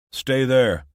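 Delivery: put-on loud call to the children. A narrator's reading. Father calls to the children, loud call. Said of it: "Sam called to them".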